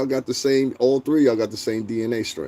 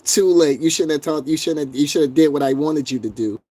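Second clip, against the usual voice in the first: higher, snooty put-on voice; mocking chatter voice; 5 semitones higher than usual. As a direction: sneer like a smug troll. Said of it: "That's how these people sound to me".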